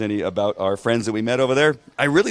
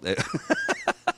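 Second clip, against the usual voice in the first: high laugh; 5 dB quieter than usual. Real, not acted. Laughs at viewer comment.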